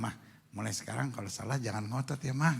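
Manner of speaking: soft, low coaxing; gentle husband. Shown as a demonstration. Modelling a husband's words to his hugged wife, soft and gentle. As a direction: coax her quietly and tenderly.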